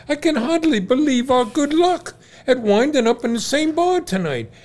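very high, loud, overjoyed Irish voice; excited Irish brogue. Retold or imitated. Overjoyed barfly marvelling at their luck, in a high, loud Irish brogue. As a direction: gush with delight in an Irish lilt.

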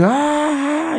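high drawn-out "aah"; reenacted startled cry, held long and high. Man startled in his shower, high drawn-out cry.

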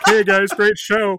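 high, slow, loud fake fan voice; disguised voice. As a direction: put on a gushing stranger's voice. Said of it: "in a disguised voice".

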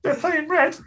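squeaky, very high mother-in-law voice; mother-in-law character. Acted. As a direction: insist as a clueless mother-in-law, pitched right up. Acting the clueless mother-in-law.

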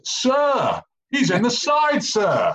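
high-pitched, yelped appeal to the referee; top-of-voice shout. Retold or imitated. Player appealing to his coach as ref in a mauling session, shouted high. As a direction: re-enact shouting at the top of your voice. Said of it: "I just shout at the top of my vo-, voice".

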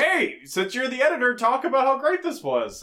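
hammed-up cheerful teacher prompting a student; mock teacher. Recapping a teacher's line to the class, mock upbeat.

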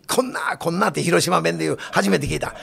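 lightly voiced Hiroshima local; Hiroshima dialect.

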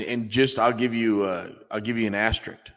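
ordinary talk, nothing marked

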